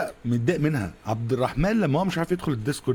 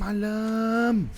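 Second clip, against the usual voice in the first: علام drawn out very slow and much higher, falling at the end; long high wail; 10 semitones higher, much slower than usual. Real, not acted. Gamer wailing at teammate علام, long high drawn-out call.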